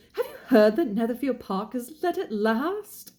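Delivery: high-pitched British lady with news; gossipy wife, voice lifted, British accent. Acted. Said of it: "said his lady to him".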